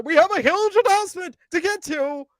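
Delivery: high-pitched mock announcer voice; hammed-up president teasing big news; Tony Khan. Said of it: "in my best Tony Khan impersonation".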